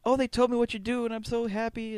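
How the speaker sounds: high, lightly voiced happy girlfriend; mock girlfriend. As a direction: gush like a proud girlfriend. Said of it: "his girlfriend comes up".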